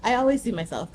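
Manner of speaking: ordinary delivery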